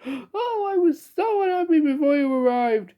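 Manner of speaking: high, crying wail. Acted. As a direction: wail it through tears. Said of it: "he wailed".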